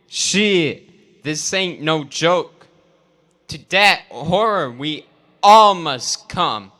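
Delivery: normal talking voice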